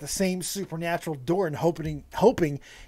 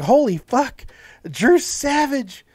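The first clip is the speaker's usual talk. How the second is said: louder, higher amazed outburst; awed shock; 6 semitones higher, 7 dB louder, slower than usual. Retold or imitated. Host reliving his reaction to a savage insult, awed shock. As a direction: blurt it out, floored and impressed.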